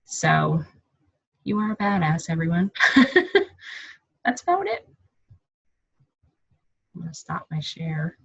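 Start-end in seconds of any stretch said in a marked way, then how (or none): 2.7-3.9 breathy laugh. Real, not acted. Laughs finishing her share.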